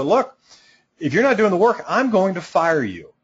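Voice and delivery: high, loud, playful warning; direct, playful threat. Shown as a demonstration. Coach to a client who won't work, playful direct warning. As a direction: look them in the eye and warn them, half-joking. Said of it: "look 'em straight in the eyes and go".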